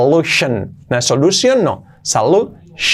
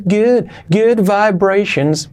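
high, stretched-out English phrase; drawn-out demo; 8 semitones higher, much slower than usual. Shown as a demonstration. Teacher showing students how vibrations is said, drawn-out demo. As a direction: stretch each word out slowly and high.

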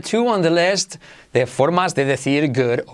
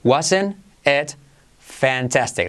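"Wasn't it... fantastic", slower, split, falling; modelo por partes; much slower than usual. Shown as a demonstration. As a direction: separate "Wasn't it" from "fantastic" for learners. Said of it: "Repite conmigo". ("Wasn't it... fantastic" pausado y descendente)

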